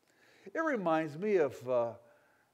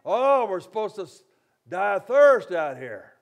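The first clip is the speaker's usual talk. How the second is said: higher, louder hammed-up complaint; loud mock whining; 5 semitones higher, 14 dB louder than usual. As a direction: whine it like a grumbling crowd. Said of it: "came to him with their complaint".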